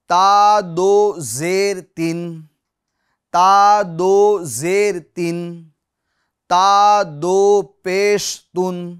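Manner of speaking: loud, very slow ता दो ज़बर तन, each syllable drawn out; teacher leads a loud repeat-after-me drill; slow recital spelling. Shown as a demonstration. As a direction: call out the spelling slowly for students to repeat. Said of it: "मेरे साथ दोहराते रहिएगा".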